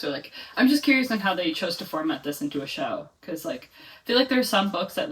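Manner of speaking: usual speaking voice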